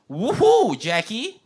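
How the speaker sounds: high, loud woohoo with an exclamation mark; excited cheer. Shown as a demonstration. Host reading a chat message as an excited cheer. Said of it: "with no exclamation mark".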